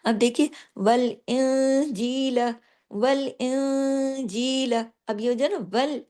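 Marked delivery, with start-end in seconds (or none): 0.8-4.7 वल इंजील very slow, with light thin letters; light letters recitation. Shown as a demonstration. Teacher reciting light letters for her class, very slow recitation. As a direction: recite वल इंजील gently, lips spread as if smiling. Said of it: "बारीक हरूफ को पढ़ने का तरीका".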